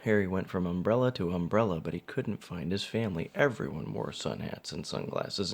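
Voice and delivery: ordinary delivery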